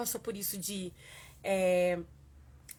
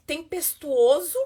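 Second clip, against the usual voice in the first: louder and drawn out slow; stormy emphasis; 6 semitones higher, 13 dB louder, slower than usual. Real, not acted. Woman describing the tense moment, drawn out and loud. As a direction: make the storm heavy in the word.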